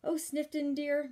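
high, cooing endearment; cooing society lady. Acted. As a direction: coo at him like a doting matron. Miss Lily addresses the doctor.

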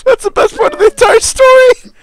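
words squeezed out through real laughter, high and loud; laughing while talking. Real, not acted. Friend still laughing about the frosted tips. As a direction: gasp it out mid-laugh.

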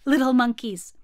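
plain reading voice

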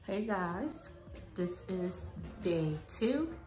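ordinary delivery

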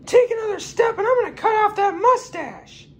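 very high, shouted knife threat; bully them in a shrill cartoon pitch; a knife-wielding stranger in a very high voice; threatening stranger. Threat to cut mustache.

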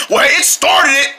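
ordinary delivery

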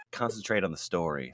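gently but firmly steer back; quiet firm reminder; kind yet firm. Acted. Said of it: "in a kind yet firm tone".

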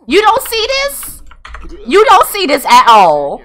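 high, loud, drawn-out disbelief; incredulous. Real, not acted. Player to oblivious Sim, incredulous. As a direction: lean hard on it, loud and astonished.

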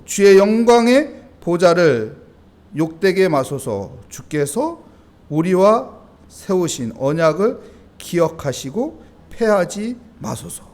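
slow, loud, drawn-out recitation of a prayer; solemn scripture reading. Acted. Preacher reads Jeremiah's prayer to the congregation, slow and loud. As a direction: read the verse like a slow, weighty plea.

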